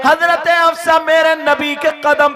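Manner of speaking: loud, high fervent sermon climax; preaching at full force. Acted. Preacher rousing the gathering, loud fervent preaching. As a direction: drive the story home at full force.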